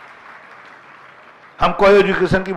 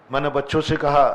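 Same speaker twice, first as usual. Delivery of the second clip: normal talking voice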